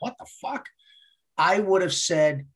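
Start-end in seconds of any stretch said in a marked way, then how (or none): 0.0-0.7 hushed, high, disbelieving aside; baffled mock reaction. Voicing listeners' reaction to the host, hushed disbelief. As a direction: imitate a stunned listener under the breath.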